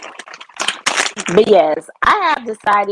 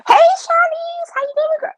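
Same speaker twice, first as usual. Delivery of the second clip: excited greeting; 4 dB louder than usual. Real, not acted. Streamer greets Shanice as she joins, excited high greeting. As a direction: call it out high and loud to a friend. Greeting a friend joining the live.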